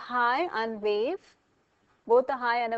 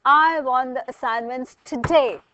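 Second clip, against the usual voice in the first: angry, emphatic demand; 9 dB louder than usual. Shown as a demonstration. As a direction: demand it forcefully, hitting TODAY hard. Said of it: "you're angry and".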